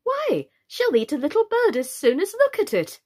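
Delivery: put-on high, lively girlish boasting; eager. A narrator's reading. Alice talking about her pet, eagerly. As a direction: gush with big swoops of pitch. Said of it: "Alice replied eagerly".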